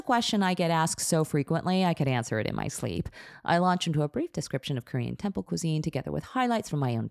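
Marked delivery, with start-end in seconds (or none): none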